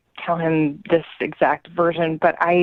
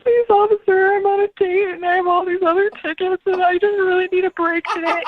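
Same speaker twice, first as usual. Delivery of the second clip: high, whiny mock pleading; mock blubbering; 11 semitones higher than usual. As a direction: imitate a man tearfully begging an officer. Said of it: "he's, like, blubbering".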